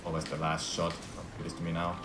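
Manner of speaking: ordinary delivery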